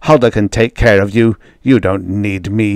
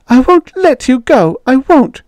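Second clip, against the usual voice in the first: high, loud frantic insistence; hysterical refusal; 5 dB louder than usual. A narrator's reading. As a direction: refuse desperately, clinging on. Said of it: "You're hysterical".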